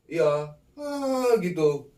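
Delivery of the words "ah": high, louder drawn-out ghost laugh; imitated kuntilanak cackle. Kuntilanak voice sweeps front to back, imitated high laugh.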